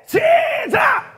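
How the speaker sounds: very slow, high, loud shout; drawn-out start cry. Real, not acted. Preacher starting the congregation's cry, long shout. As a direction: stretch the start call out. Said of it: "준비".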